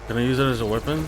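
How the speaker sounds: asking fast mid-fight; urgent question. Real, not acted.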